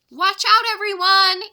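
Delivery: loud warning to shoppers; warning cry. Acted. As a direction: warn the crowd ahead. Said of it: "Annie cried".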